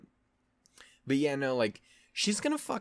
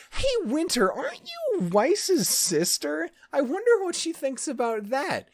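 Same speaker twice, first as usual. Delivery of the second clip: much higher and louder, sly taunting voice, slowed at first; mock villain taunt; 4 dB louder than usual. James taunting Winter about her sister, high gloating voice. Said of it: "he goes like".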